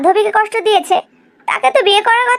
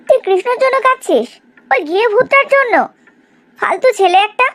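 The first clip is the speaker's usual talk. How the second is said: scornful; 4 semitones higher than usual. Acted. Friend scorns crying over Krishna.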